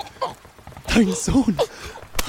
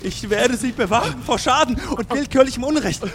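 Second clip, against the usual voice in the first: usual speaking voice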